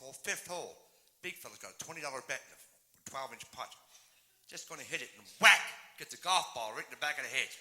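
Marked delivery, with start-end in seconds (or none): 5.4-5.6 sudden loud, punched WHACK; explosive sound-effect shout; imitated whack. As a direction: burst out with the sound of the hit. Imitates putter hitting the ball.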